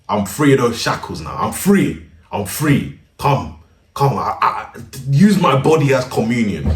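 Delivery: loud, hammed-up jubilant declaration; mock liberation. Freed from all-boys school, hammed-up rejoicing. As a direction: proclaim it like a man just set free.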